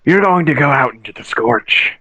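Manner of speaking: slow, hushed impression of a mumbling character; whispering character impression. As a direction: half-whisper it slowly like a brooding rogue. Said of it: "He's always whispering".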